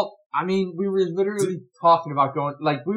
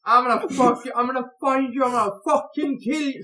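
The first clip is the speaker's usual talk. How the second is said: put-on Irish accent threat; 9 semitones higher, 5 dB louder than usual. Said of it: "Think like Conor McGregor's Irish accent".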